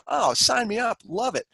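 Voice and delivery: sarcastic, high-pitched fake eagerness; mock enthusiasm. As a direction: pretend to be thrilled, dripping with irony. Mocking students' imagined enthusiasm.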